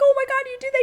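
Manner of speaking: very high mock squeal; imitated giddy backstage excitement; super excited. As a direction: squeal it like a thrilled friend. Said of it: "they're, like, super excited".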